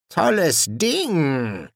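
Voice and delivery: very slow, drawn-out demon voice; slow, impressed demon; grunted admiration. A narrator's reading. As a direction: draw out each word in admiration. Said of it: "brummte Ligur".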